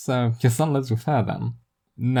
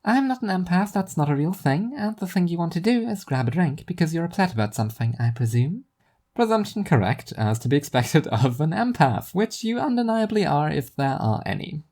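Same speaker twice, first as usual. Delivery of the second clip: higher, teasing friend; Zarya's teasing voice; 7 semitones higher than usual. Acted. Zarya teasing Seth about his bad day, high voice. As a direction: rib him gently, pitched up.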